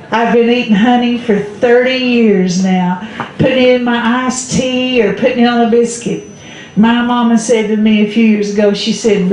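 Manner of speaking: usual speaking voice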